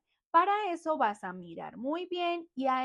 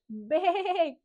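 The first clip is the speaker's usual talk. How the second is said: drawn-out sheep baa; imitación de oveja; 3 semitones higher than usual. Shown as a demonstration. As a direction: bleat long like a sheep. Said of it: "La oveja hace". (balido alargado de oveja)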